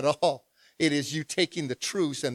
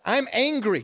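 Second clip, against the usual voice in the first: ordinary talk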